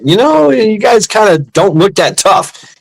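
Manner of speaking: high, slow, needling doubt; lifted-pitch sizing-up, quoting yourself; retold teasing taunt. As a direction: imitate a cocky challenge, drawn out. Retold taunt to the smaller marine.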